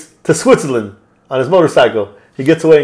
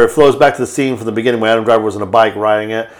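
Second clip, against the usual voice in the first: ordinary talk, nothing marked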